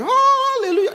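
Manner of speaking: high, far-off shouted hallelujah; heavenly choir shout. Retold or imitated. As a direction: sing out like a heavenly host.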